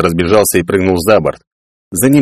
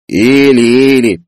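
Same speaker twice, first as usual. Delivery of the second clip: higher, drawn-out mocking echo; mocking mimicry; 5 semitones higher, 4 dB louder, much slower than usual. A narrator's reading. Peller mimics his brother's list of guesses, mocking. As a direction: mimic them with exaggerated scorn. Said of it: "передразнил Пеллер".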